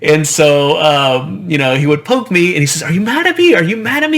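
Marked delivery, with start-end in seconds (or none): 2.8-4.2 high, playful teasing voice, much higher; teasing grandpa. Retold or imitated. Grandpa teasing his grandchild in a high playful voice. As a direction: tease like a grandpa tickling a sulky kid.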